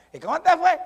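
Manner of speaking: very high, loud mock question; wrong courting voice. Suitor she would ignore, comically high and loud. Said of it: "Porque si le hubiera hablado".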